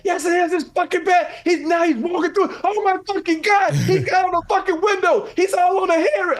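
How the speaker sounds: high, loud frantic yelling; retold yelling. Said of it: "I'm yelling".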